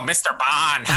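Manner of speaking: light suave Bond-villain voice; a hint of Dalton's Bond; Timothy Dalton impression. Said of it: "Come on, do the Dalton".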